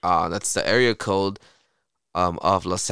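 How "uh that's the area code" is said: normal talking voice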